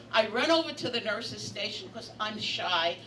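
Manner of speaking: normal talking voice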